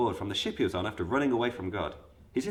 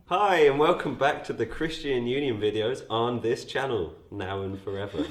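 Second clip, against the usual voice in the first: slow, formal broadcast delivery; mock newsreader welcome; news anchor voice; 3 dB louder than usual. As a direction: open like a TV news presenter. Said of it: "It's not a news broadcast".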